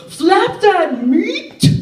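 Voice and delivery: high, slow drawn-out yell; yelled from a car. Retold or imitated. Stranger yelling from a passing car, slow high yell. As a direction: bellow it out a car window. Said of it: "someone yells".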